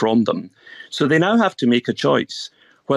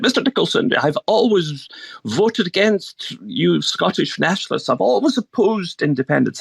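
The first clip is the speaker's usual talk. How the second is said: posh voice; 6 semitones higher than usual. Retold or imitated. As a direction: put on a posh elderly lady voice. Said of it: "This is my posh voice I'm putting on here".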